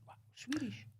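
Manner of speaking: high, soft impression of an amazed American; imitating Tennessee local. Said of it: "you get down to Tennessee".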